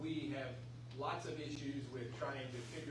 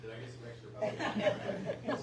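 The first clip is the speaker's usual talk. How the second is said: slow, laughing question; 7 semitones higher, 6 dB louder, slower than usual. Real, not acted. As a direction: laugh through the joke. Joking after mic mishap.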